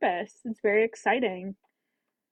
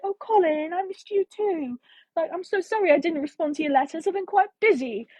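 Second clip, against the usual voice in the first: higher, sugary mock reply; mock sweet Penelope; 6 semitones higher than usual. Mocking what Colin hoped Penelope would say, high and sweet. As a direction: play the gushing, apologetic girl. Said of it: "Her just be like".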